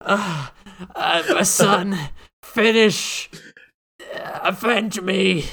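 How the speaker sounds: slow, hammy dying gasp of a last request; overacted death scene. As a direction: ham it up like a dying father. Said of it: "Was this his dying wish?".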